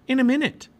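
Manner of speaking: high, loud, excited reply; eager put-off. A narrator's reading. Girl not ready to go back, eager put-off. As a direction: brush off the suggestion eagerly.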